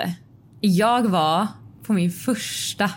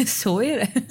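laughing, higher-pitched echo; amused echo; 4 semitones higher than usual. Real, not acted. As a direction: say it back through a laugh. Amused echo of the other's resignation.

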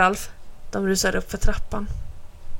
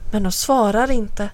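plain reading voice

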